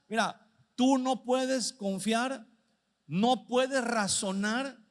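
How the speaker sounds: normal talking voice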